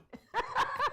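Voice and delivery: high, weak not-too-villainy cackle; villain laugh. Pretend. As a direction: try a mustache twirling villain cackle that falls short. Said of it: "my villain laugh is not too villainy".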